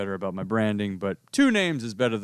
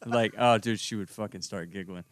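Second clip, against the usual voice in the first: ordinary talk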